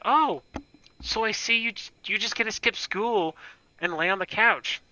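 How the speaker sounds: high, sneering sister voice; mock her teasing jealousy. Older sister teasing the injured brother, mock sneer. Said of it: "she was like".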